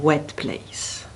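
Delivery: ordinary delivery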